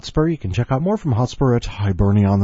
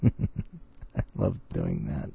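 low laughing; 11 semitones lower, 7 dB quieter, much slower than usual. Real, not acted. Low slow laughing remark.

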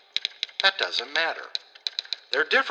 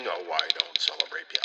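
low, soft mock-solemn voice; mocking pious quote; 7 semitones lower, 5 dB quieter than usual. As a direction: imitate a sanctimonious person, dropping low. Mocks people's pious public statements.

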